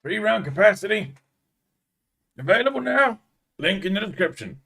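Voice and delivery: mock sales pitch; spoof advert announcer. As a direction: sell it like a parody commercial. Spoof advert presenting cat as gun.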